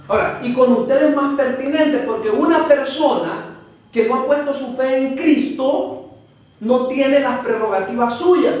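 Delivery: ordinary talk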